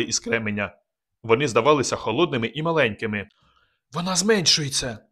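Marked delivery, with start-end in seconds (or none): 3.9-5.0 higher, scared half-whisper on «зменшується»; frightened whisper. A narrator's reading. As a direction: breathe it in fear. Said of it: "прошепотіла Мар'яна злякано".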